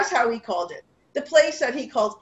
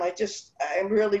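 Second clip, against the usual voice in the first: ordinary talk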